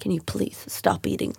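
hushed, breathy aside mimicking the woman; retold whispered scolding from a stranger; mocking whisper. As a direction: whisper it like a judgy neighbour. Retold glares from woman on plane.

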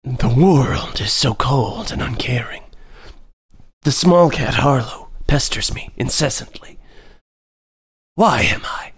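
slow, whispered gloomy cat musing; mopey whispered cat. Retold or imitated. Depressed cat Maisie complaining about Harlow, slow and whispered. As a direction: mope like a tragic cat poet. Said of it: "Maisie's like".

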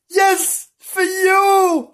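very high, sing-out mummy voice; excited mummy. Acted. Mummy telling the children it's for them, high excited. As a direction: announce it high and loud, drawn out.

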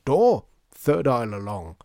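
loud, slow called-out order; triumphant calling. Acted. Shopkeeper sending assistant for tins, calling loudly. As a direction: call across the shop in triumph. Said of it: "cried Mister M triumphantly".

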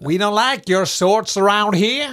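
menacing Texas drawl; American accent. Acted. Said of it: "Hva var det igjen?".

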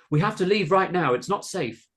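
high, urgent shouted warning. A narrator's reading. Carolina warning children to leave the farm, urgent shout. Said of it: "Carolina shouted".

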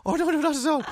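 very high, rushed no-no-no; frantic high reassurance. Retold or imitated. Bleeding customer reassuring the tearful barber, very high. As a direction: squeak out that he's fine.

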